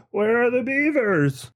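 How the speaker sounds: high, drawn-out searching call; mock diver. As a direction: stretch it out in a high mocking voice. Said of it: "He's like".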